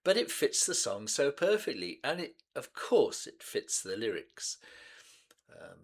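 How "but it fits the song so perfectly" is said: ordinary delivery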